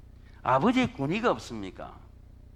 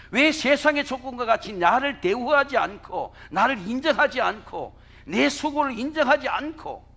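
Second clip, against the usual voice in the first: higher and louder, building indignation; bitter protest; 7 semitones higher, 5 dB louder than usual. Retold or imitated. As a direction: hammer each grievance. Elder son's resentful complaint. (큰아들처럼 높고 크게 불공평을 항변)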